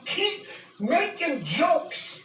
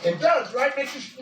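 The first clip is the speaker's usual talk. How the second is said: ordinary talk, nothing marked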